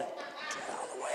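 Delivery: voiceless mimed whisper; retold whispered demand; mouthed order. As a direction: barely let the words out, as if mouthing. Acts woman quoting his silent order.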